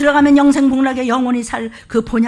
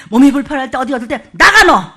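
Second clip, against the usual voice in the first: louder, stern command to leave; casting out; 9 dB louder than usual. Shown as a demonstration. Pastor models commanding illness to leave, loud and stern. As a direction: drive it out with a sharp order. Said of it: "쫓으십시오".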